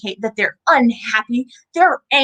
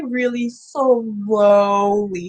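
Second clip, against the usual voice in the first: very slow; 3 semitones lower, much slower than usual. Shown as a demonstration. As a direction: stretch it out very slowly, like a tortoise. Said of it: "remember, tortoises move really".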